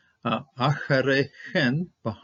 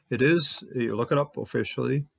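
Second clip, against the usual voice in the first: normal talking voice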